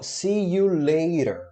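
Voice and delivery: slow, clear teacher model; slow model phrase. Shown as a demonstration. Teacher modeling "see you later" slowly for students. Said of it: "É o nosso te vejo mais tarde".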